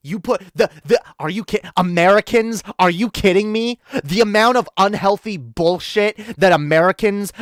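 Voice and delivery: usual speaking voice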